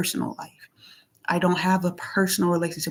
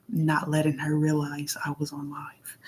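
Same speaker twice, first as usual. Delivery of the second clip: unvoiced whisper, as if keeping a secret; 3 semitones lower, 6 dB quieter than usual. Real, not acted. Telling viewers she hid being live, whispered. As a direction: drop to a whisper.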